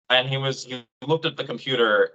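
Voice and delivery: ordinary talk, nothing marked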